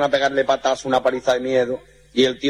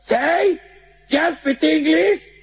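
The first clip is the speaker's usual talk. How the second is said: very high, very slow mock English; much slower than usual. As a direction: stretch out the fake English. Mexican attempts English.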